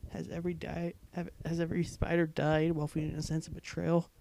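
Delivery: ordinary delivery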